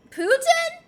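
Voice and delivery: high loud disbelieving question; shocked, almost laughing; incredulous. Real, not acted. Streamer reacts to chat's joke, high loud disbelief.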